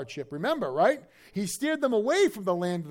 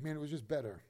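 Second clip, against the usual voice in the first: much softer, low longing; wistful backslider; 4 semitones lower, 11 dB quieter than usual. Retold or imitated. Preacher voicing a backsliding believer, soft and low. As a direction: sigh it quietly, missing the old life.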